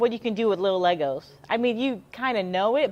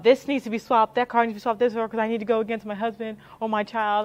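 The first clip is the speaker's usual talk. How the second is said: louder, higher mock demand to swap cars; pushy competitive parent; 3 semitones higher, 4 dB louder than usual. A parent insists on swapping cars, mock pushy and louder. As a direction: play the parent who wants to beat their husband.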